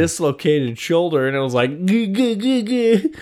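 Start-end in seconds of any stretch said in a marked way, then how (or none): 1.9-3.1 strained grunts. Retold or imitated. Retold pain pulling bow.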